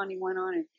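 usual speaking voice

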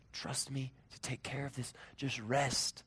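soft, soothing God; gentle reassurance. Acted. God telling us to trust, softly reassuring. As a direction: quietly invite them to rest. Voicing God's comfort.

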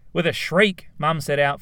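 a touch of alarm in the telling; urgent. A narrator's reading. Narrator urging on Mum's frantic dash, urgent. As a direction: rush the words as Mum bolts. Mum rushes out.

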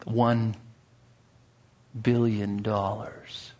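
slow, soft, greedy drawl; greedy slow price. Acted. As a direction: savour each word, slyly. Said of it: "A greedy smile crept upon his face".

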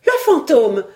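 high, loud cry of fright; startled, frightened cry. A narrator's reading. Housekeeper startled by the journalist clapping his hands, frightened cry. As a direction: cry out as if you've seen a ghost. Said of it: "eut un cri de surprise et de frayeur".

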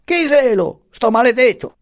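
high-pitched irritated cry; vociferating. A narrator's reading. Puttini demands who is knocking, vociferating. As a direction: shout down in a high, cross voice. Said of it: "vociferò il Puttini".

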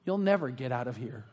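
low, quiet voice from a dark corner; man in the darkness. Acted. Hidden man to the newcomer in the grave, low quiet voice. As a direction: drop it low and soft, ominously. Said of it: "the man in the back corner in the darkness says".

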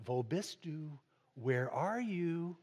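soft, slow German quote of a father; hushed Wo bist du then slow Where are you; quoting dad in German. Retold or imitated. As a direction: ask it gently like his dad did. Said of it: "My dad always used to say it in German".

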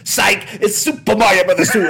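hammed-up, high, loud gotcha reveal; Video Game Donkey. Podcaster mimicking a YouTuber's plot twist, gleeful mock shout. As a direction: burst out with the fake-out, imitating a YouTuber. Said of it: "it's like a plot twist thing 'cause he's like".

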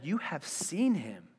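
slow, softened verse reading; measured scripture reading. A narrator's reading. Preacher reading Jesus' words to the congregation, measured. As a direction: read it slowly, letting it sit.

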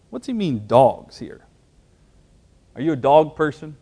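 ordinary talk, nothing marked

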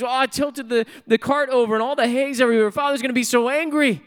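high-pitched imitation of a frantic boy; panicked boy. Boy explaining the spill to a neighbor, mock panic. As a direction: blurt it out as a distressed child, hammed up.

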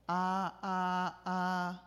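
"ah" held high and perfectly level; three even high notes on "ah", no glide. Shown as a demonstration. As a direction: keep each "ah" on one steady pitch. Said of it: "a level tone".

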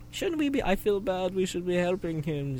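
high, soft girlish voice, imitated; girly voice. Friend voicing the anime girls' joke, high soft girly voice. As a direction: worry sweetly like a young girl. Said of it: "they made some cute jokes".

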